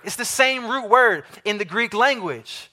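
ordinary talk, nothing marked

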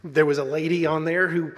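ordinary delivery

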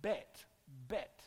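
Bet with a hard final t; Hebrew word. Shown as a demonstration. Pastor teaching the Hebrew word, Bet said carefully. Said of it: "the word for town or house".

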